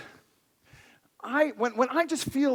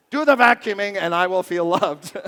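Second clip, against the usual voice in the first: louder, mock commanding wife; mock bossy wife; 2 semitones lower, 8 dB louder than usual. Pastor imitating his wife ordering chores, mock bossy. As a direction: play the demanding wife for laughs. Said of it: "She doesn't say it in that tone".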